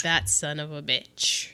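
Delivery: low muttered curse. Retold or imitated. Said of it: "She's like".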